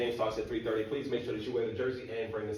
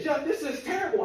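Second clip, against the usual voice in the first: put-on much higher, incredulous girlfriend; girlfriend's voice; 6 dB louder than usual. Girlfriend shocked at his report card, high incredulous voice. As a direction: squeak it out in disbelief.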